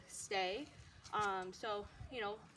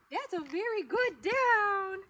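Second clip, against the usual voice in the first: slow drawn-out praise; 8 semitones higher, 8 dB louder, much slower than usual. Real, not acted. Praising dog holding down.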